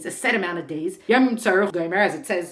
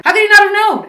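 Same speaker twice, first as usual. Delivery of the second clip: sharp high, loud burst of disbelief; astonished outburst; 11 semitones higher, 10 dB louder than usual. Real, not acted. Teacher asking the class in disbelief, loud astonished outcry. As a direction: cry it out in amazement.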